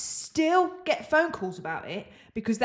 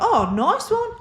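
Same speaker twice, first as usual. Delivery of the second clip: mock delight; 11 semitones higher, 4 dB louder than usual. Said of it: "And I was like".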